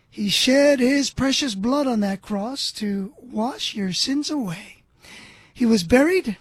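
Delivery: ordinary delivery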